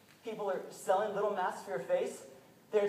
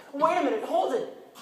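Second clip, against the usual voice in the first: loud, higher panicked father calling out; alarmed protest; 9 semitones higher, 10 dB louder than usual. Retold or imitated. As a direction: stop them, alarmed. Father protests as boy is taken.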